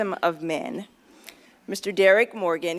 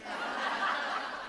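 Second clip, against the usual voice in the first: a soft, high self-conscious chuckle; embarrassed laugh; 6 semitones higher, 10 dB quieter than usual. Real, not acted. Admitting she nearly backed out, a soft embarrassed chuckle. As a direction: laugh at yourself, a little sheepish. Embarrassed at her own story.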